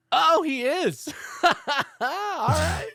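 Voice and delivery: high, loud burst of surprise; loud, high-pitched disbelief giving way; astonished realisation. Real, not acted. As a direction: exclaim as the doubt is suddenly overturned. Sees the casting is true.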